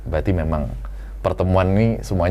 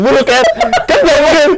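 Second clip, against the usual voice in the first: laughing; 9 dB louder than usual. Real, not acted. Embarrassed at the request.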